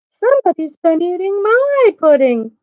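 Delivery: high-pitched Mama Bear; middle-sized voice. A narrator's reading. Mama Bear finds her pudding eaten, middle-sized high voice. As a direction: lift it into a high storybook bear voice. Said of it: "in her middle-sized voice".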